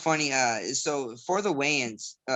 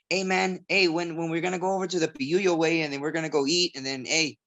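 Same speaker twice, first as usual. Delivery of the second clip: put-on higher-pitched Southgate neighborhood accent; 4 semitones higher than usual. Fighter showing how he talks with people from Southgate, in a Southgate accent. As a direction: slip into casual Southgate street talk. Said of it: "it's like accents that come out".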